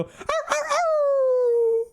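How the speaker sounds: high squeaky yelps of a punted puppy; yelping dog. Pretend. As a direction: yelp like a little dog sailing away. Kicked dog flying off, yelping.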